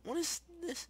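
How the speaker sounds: very high-pitched baffled whine; high, strained bewilderment without shouting; incredulous squeal. Real, not acted. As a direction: squeal it in disbelief. Baffled at lady in house.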